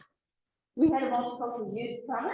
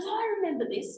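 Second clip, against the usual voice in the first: a higher, wondering recall from her dad; dad recognising; 9 semitones higher than usual. Retold or imitated. A hint of her dad recognising Nazi memorabilia, wondering. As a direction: quote dad's recognition, pitched higher. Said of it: "he goes".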